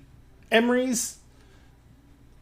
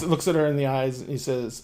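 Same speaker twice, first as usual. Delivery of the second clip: normal talking voice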